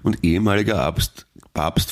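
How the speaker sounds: ordinary talk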